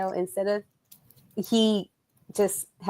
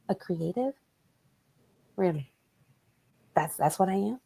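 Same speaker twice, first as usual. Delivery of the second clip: quiet offended; 7 dB quieter than usual. Retold or imitated. Her offended retort, quieter.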